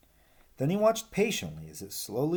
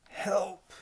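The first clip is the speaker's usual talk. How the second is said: croaked; 10 semitones higher than usual. Acted. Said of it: "he croaked".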